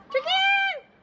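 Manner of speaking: very high, loud shout; calling from far away. Real, not acted. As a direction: yell it across the field. Calling the chicken back.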